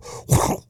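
voiceless whoosh of flying wipers; quick imitated noise of something whipping away. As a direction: blow out a short mouthed whoosh. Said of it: "both windshield wipers go".

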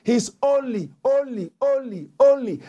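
only, only, ONLY as a preacher's climax; building stress on only. Shown as a demonstration. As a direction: drive home "only" with rising force. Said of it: "that he gave what".

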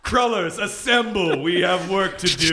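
rally the cake army grandly; hammy stage actor. Pretend.

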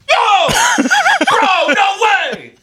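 very high, shrieked outbursts; imitated gamer kids yelling. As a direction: yell like an overexcited kid gamer. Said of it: "all I hear from, like, three rooms away is".

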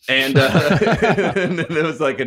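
real laugh bubbling up mid-sentence. Real, not acted. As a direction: laugh through the words. Laughing at remembered text reply.

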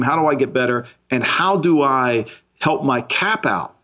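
ordinary talk, nothing marked

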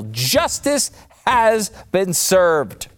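drawn-out hammed-up triumph; mock solemn verdict. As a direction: announce it like a movie judge.

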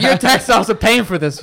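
lightly put-on Boston grievance; Boston accent. Old local griping at visitors about taxes, imitated Boston accent. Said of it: "had the thickest Boston accent in the world".

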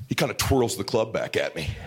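ordinary delivery

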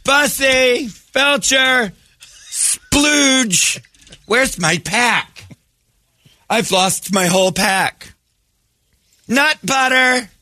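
very high, drawn-out dog call. Pretend. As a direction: shout the name across the park, high. Calling dogs in a park.

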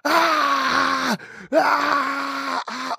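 loud high-pitched imitated scream of pain; long, high shrieks, as if woken by pain. Dying father waking at night in pain, screaming. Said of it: "he spends the entire night screaming".

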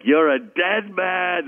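hammed-up, slow impression of a threatening rival; mock threat. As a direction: stretch each word into a theatrical menace. Acting out the singer's playful threat.

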